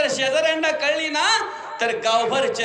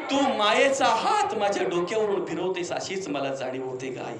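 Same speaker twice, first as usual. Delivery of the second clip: tearful longing; 8 semitones lower, 4 dB quieter than usual. Real, not acted. Longing for mother's touch.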